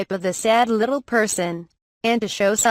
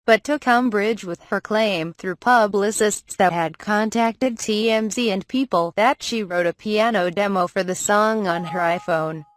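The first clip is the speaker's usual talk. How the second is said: ordinary talk, nothing marked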